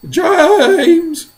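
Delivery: very high, loud bawl. A narrator's reading. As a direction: bawl the name in despair. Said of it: "bawled the centipede".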